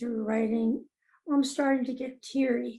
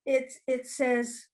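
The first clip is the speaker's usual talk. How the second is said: normal talking voice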